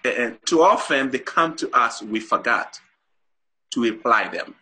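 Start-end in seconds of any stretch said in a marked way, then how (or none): none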